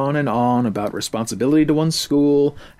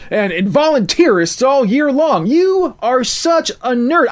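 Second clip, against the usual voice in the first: much higher, loud, exasperated yelling; furious shout; 9 semitones higher, 5 dB louder than usual. A narrator's reading. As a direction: yell like he's finally snapped. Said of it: "had reached his limit and shouted".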